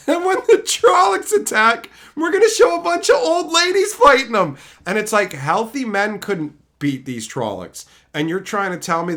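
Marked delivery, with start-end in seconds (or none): 0.1-4.6 loud mocking. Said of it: "They're like".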